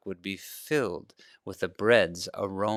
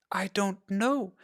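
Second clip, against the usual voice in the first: put-on young girl voice; 11 semitones higher, 4 dB quieter, slower than usual. A narrator's reading. Girl answers running off.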